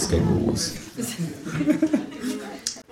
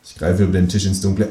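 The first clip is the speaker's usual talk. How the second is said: ordinary delivery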